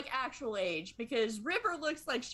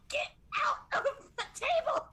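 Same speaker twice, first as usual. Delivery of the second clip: high, laughing exclamation; exclaiming River; 14 semitones higher than usual. Acted. Player voices River at the table, high-pitched outburst. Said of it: "She's like".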